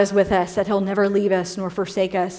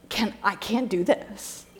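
overwhelmed; 8 dB quieter than usual. Retold or imitated. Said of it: "I'm like".

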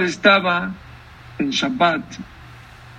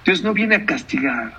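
usual speaking voice

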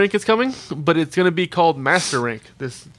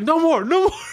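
high, pleading mock exasperation; exasperated plea; 11 semitones higher than usual. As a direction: beg it to stop, high. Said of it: "there's like".

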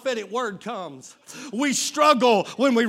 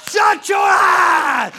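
slow, loud, higher command; shouted command; 7 semitones higher, 8 dB louder, much slower than usual. Real, not acted. Preacher commanding the congregation with a slow loud shout. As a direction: bark it out slowly.

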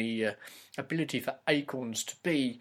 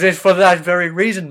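higher, much louder outburst; indignant protest; 5 semitones higher, 15 dB louder than usual. Acted. Son protesting to his mother, indignant. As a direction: burst out in mock indignation, loud and raised.

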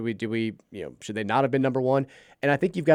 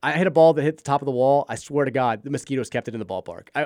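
ordinary talk

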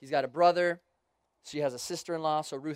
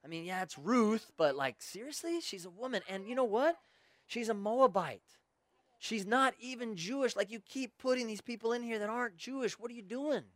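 incredulous Timothy; 5 semitones higher than usual. Retold or imitated. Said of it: "Tim's like".

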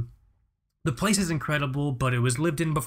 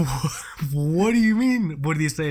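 baffled; 3 semitones higher, 4 dB louder than usual. Retold or imitated. Son baffled by dad's question.

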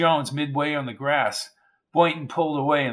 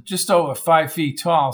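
ordinary delivery, nothing marked

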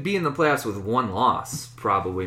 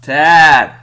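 loud greeting; 5 semitones higher, 16 dB louder than usual. Real, not acted. Greeting a phone caller.

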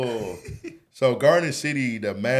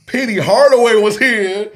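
high, loud, thrilled reveal; amazed announcement; 10 semitones higher, 12 dB louder than usual. Retold or imitated. Classmates telling him who came, high thrilled reveal. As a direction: blurt the star's name in awe. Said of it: "they were like".

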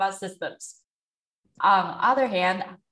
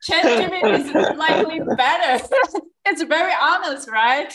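reading it out through laughter; laughing; 9 semitones higher, 7 dB louder, faster than usual. Real, not acted. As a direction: laugh while reading the answer. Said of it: "It's just so funny".

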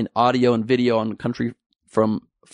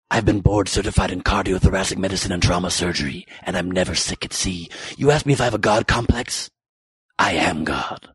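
lower, grave movie-doctor voice ending in a whisper; Baldwin's Malice speech; 5 semitones lower than usual. Retold or imitated. Comic quotes Baldwin's Malice monologue, low and ominous. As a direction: quote the God complex line darkly, whisper the end. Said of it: "what he said in the movie Malice".

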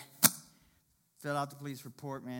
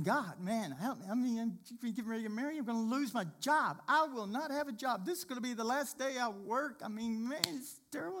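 crying out to God; 6 semitones higher than usual. Retold or imitated. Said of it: "I started crying out to God".

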